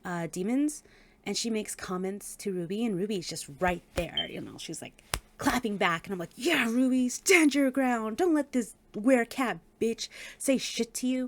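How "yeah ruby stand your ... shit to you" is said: higher, punchy hype for a heroine; cheering her on. Retold or imitated. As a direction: egg her on like a fired-up fan.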